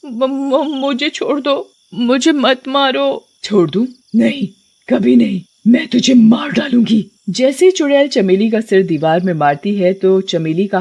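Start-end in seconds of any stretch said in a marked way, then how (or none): 0.1-3.3 high, stammering plea; frightened victim begging, voice pitched up; choked terror. Acted. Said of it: "गला और जोर से घोटने लगती है". 3.4-7.1 mounting menace in short bursts; hammed-up furious witch; vengeful witch threat.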